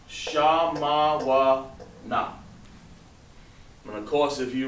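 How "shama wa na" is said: Shama wa na stretched out very slowly. Shown as a demonstration. Teacher dictating the word very slowly for students to write. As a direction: draw each syllable out for dictation. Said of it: "Okay, so".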